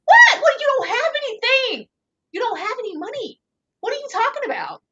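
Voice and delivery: high, loud incredulous What; outraged disbelief. Real, not acted. Reacting to his claim, outraged high disbelief. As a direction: shriek of disbelief at his nerve.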